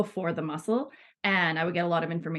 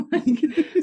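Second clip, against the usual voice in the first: a laugh bursts through the word, higher and louder; 9 semitones higher, 5 dB louder than usual. Real, not acted. Telling a guest about clients crying, laughing. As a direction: let the laugh carry it up. Laughs while describing emotions coming out.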